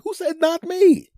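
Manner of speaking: very high, slow mock panic; startled. Homeowner catching the fridge talking, high mock panic. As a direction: shriek it out in disbelief.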